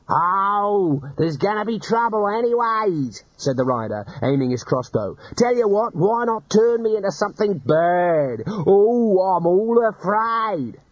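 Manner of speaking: mock fear. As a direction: fake being scared, jeering. Bandit mocking fear.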